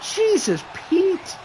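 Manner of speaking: high, startled exclamation; shocked exclamation. Real, not acted. Commentator shocked by wild move, high startled blurt.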